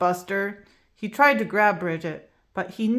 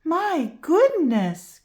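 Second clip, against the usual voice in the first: surprised cry; 8 semitones higher, 4 dB louder, slower than usual. Acted. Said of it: "cried Miz Pickles".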